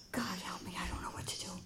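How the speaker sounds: desperate whisper to God; acted panicky whispered prayer; whispered plea. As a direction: whisper a helpless little prayer, very soft. Retold desperate silent prayer.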